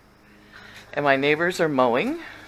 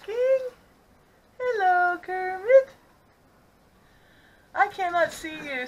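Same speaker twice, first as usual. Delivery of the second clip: much higher cooing to the frog; high pet-talk voice; 7 semitones higher, slower than usual. Real, not acted.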